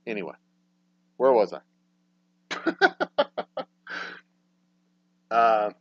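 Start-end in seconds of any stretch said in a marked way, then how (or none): 2.5-3.6 high laugh. Real, not acted. Laugh after rant.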